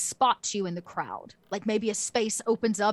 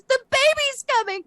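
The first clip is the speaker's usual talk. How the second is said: very high, loud panicked wail; screaming in fake labor; 6 dB louder than usual. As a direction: cry out so the whole crowd turns. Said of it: "where Lamara screams".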